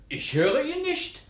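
ordinary talk

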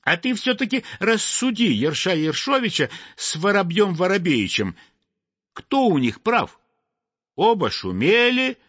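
high, insistent complaining voice; clamouring crowd. Acted. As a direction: demand a ruling on the troublemakers. The crowd demands a judgement.

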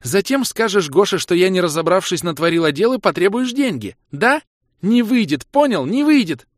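high, loud angry tirade; shrieked high-pitched indignation; furious refusal. A narrator's reading. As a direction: refuse him at full voice. Kira rages at suspected plot.

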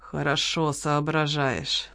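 grudging, venomous praise; said through teeth. A narrator's reading. Waitress to Lola, caught out, quiet through teeth. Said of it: "процедила официантка".

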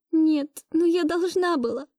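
high, distressed protest; trying to object. A narrator's reading. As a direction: object tearfully, pitch well up. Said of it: "попыталась я возразить". (возражая, высоким расстроенным голосом)

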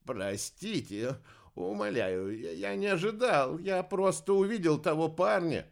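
beg, frightened, rising in panic; trembling voice. A narrator's reading. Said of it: "дрожащим голосом произнёс". (дрожащим голосом, испуганная мольба)

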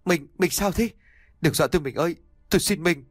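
high, pleading cry; desperate plea. A narrator's reading. Husband begs his bleeding wife, high and desperate. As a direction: beg her not to die.

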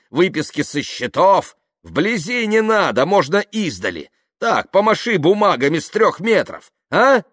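loud, high, sarcastic demands; challenging. Acted. Kaftanov daring Yuklin to show the papers, loud challenge. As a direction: taunt him at full voice.

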